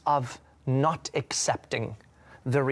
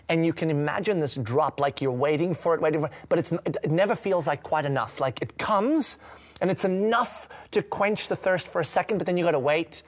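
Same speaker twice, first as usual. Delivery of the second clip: ordinary talk, nothing marked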